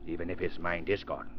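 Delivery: low scheming. Acted.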